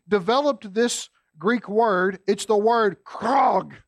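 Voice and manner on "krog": krog, croaked slowly like a crow's caw. Shown as a demonstration. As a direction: say krog as a drawn-out crow croak. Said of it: "you gotta say it like that".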